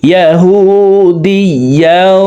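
ইয়াহুদিয়াউ chanted slowly with a nasal final glide; drawn-out ইয়াহুদিয়াউ merging into a hummed w; merged with ghunnah. Shown as a demonstration. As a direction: hold the nasal join at the end of ইয়াহুদিয়াউ. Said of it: "গুন্নার সহিত মিলাইয়া পড়তে হবে".

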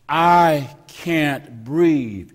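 drawn-out loud lament; loud slow plea. Retold or imitated. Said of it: "what Eric Garner said eleven times".

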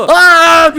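loud startled scream. Real, not acted. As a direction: scream as you die. Caught and killed.

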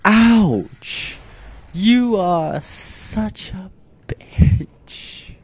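high, loud and dragged out slowly; stung, slow drawn-out outcry; mock-wounded yell. Real, not acted. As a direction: wail like you've been burned. Stung by GLaDOS jab.

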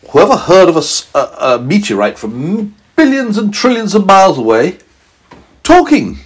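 high, disbelieving voice; astonished scientist. A narrator's reading. Scientist marvels at talking meteorite, high disbelief. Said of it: "the scientist said".

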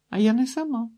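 put-on high, light girl's voice; young woman replying. A narrator's reading. Girl answers a stranger on the beach, high-pitched reply.

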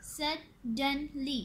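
"Suddenly" very slowly in three beats; "Suddenly" broken into three syllables. Shown as a demonstration. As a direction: say "Suddenly" as sud, den, ly with pauses. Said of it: "three syllable word".

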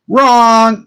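WRONG, high and loud, slammed down; emphatic wrong. Real, not acted. Telling friends the cocky plan failed, loud high emphatic. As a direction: cut yourself off with a hard high WRONG. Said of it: "self-correction of cocky claim".

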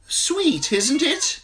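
high, bright merchant voice; oily wine seller charm; wine seller, smiling. A narrator's reading. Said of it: "the wine seller said, smiling".

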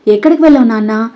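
plain reading voice

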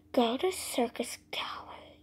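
ordinary delivery

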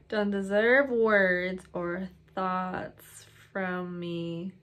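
slow, drawn-out words; spaced out, word by word; dictating while typing. Real, not acted. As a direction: read it out slowly as you type. Typing out a line as read.

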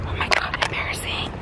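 hushed whisper so the teacher can't hear. Real, not acted. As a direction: whisper it, mortified. Whispering as the teacher walks past.

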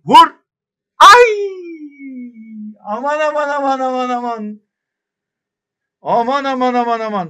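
high anxious cries, fading; near-conceded chance, alarmed; panicked. Real, not acted. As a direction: fret over a dangerous moment.

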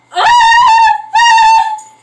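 very high, piercing, drawn-out laugh; loud shrieking laugh. Real, not acted. Vlogger cracking up while wrapping the video, shrieking laugh. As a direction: let the laugh burst out as a shriek. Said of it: "did you hear my laugh?".

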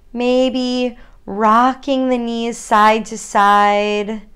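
unhurried guided yoga cues with long pauses; calm grounding exercise. Acted. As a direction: lead them gently into their body.

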